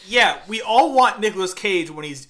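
ordinary talk, nothing marked

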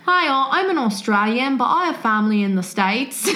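attempted Australian accent. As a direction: put on an amateur Australian accent. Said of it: "this whole story in an Australian accent".

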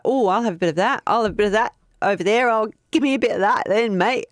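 quick, rough broad Australian bloke grabbing bones; broad Aussie accent. Retold or imitated. 1929 onlookers grabbing Ned Kelly's bones, quick broad Aussie accent. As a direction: play a greedy Aussie larrikin, rattled off fast. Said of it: "if you want these accents to stop".

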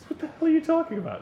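high-pitched retold outrage; mock incredulity. Visitor answering stranger's odd request, mock incredulous. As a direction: throw it back in disbelief.

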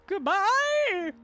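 very high, loud mock cry; soaring, over-the-top goodbye; hammed-up farewell. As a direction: squeal it out theatrically. Co-host demonstrates her own take.

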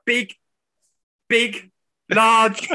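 hammed-up commentator repeating himself; imitating Gage. Teasing the pundit's repeated words, high and loud. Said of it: "But he said the same one".

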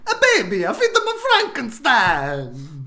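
high whiny voice with a mock Italian lilt; whiny Italian Phoenix. Correcting the impression, whiny mock Italian emperor. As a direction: whine it like a Roman with a Mario accent.

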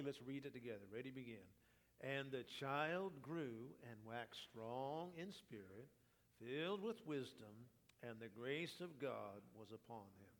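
ordinary talk, nothing marked

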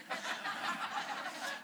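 high breathy burst of real laughter; laughing. Real, not acted. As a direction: laugh out at your own joke. Laughs at joke about Marshall.

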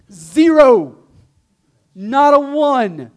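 loud, high, triumphant answer; emphatic exclamation. Real, not acted. Preacher answers his own question to the class, emphatic shout. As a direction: belt out the answer, drawing it out.

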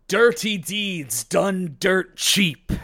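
slow, loud, drawn-out battle cry; shouted stand name. Acted. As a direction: belt out each word like an attack call.

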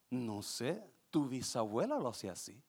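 low, very soft grandmother voice. Acted. Grandmother answering about the cut steak, low grandmother voice. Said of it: "Y va la abuelita".

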